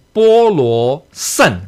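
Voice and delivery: bo luo sen, very slowly, each syllable apart; slow mantra syllables. Shown as a demonstration. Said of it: "All right, then".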